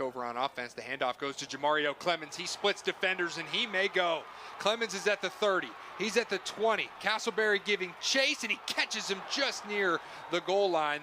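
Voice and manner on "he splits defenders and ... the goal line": excited play call. Real, not acted. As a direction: ride the run with growing excitement. Long run called live.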